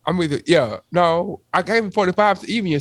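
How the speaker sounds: normal talking voice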